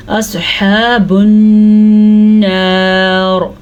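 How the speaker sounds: नार with a thick, full ra at the stop; heavy ra on pause. Shown as a demonstration. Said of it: "रॉ को हम पुर पढ़ेंगे". (वक्फ पर नार की रॉ पुर)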